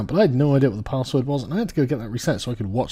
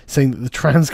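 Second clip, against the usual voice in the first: usual speaking voice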